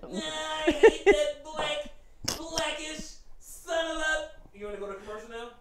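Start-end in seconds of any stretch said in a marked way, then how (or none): 0.0-4.3 high, slow caricature whine; hammed-up high bigot voice. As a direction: drag it out in a high mock-bitter wail.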